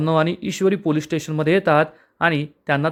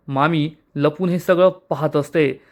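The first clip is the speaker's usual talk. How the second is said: ordinary delivery, nothing marked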